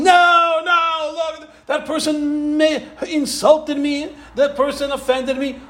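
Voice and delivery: high, whiny protest of the evil inclination; a pleading, high complaining whine; mock screaming inner voice. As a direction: play the offended ego crying out. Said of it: "your Yetzer Hara is screaming and shouting".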